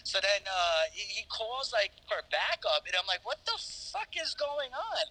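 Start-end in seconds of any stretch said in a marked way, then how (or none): 3.3-5.0 high, drawn-out retold dismay; exasperated disbelief. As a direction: stretch it out high, bewildered. His alarmed reaction, retold.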